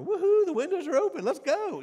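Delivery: mock celebration. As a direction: cheer like a crowd that just won.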